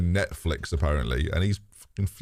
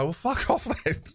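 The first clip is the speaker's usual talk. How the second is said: mock dismissive retort; 11 semitones higher than usual. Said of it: "it's a shame I can't just reply".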